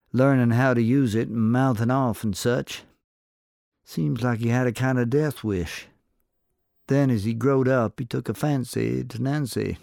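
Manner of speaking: a sly saloon barkeep angling for a drink. A narrator's reading. Barkeep bargaining with the cowboy for gossip, sly.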